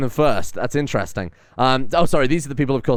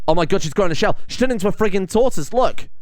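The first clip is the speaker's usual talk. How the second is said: rising, disbelieving exclamation; higher with startled disbelief; shocked; 6 semitones higher than usual. Real, not acted. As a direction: react in real shock at the screen. Shocked at tortoise mutation.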